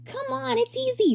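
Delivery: bright high showman voice; high cheery director voice. Acted. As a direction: play the grinning director, way up high.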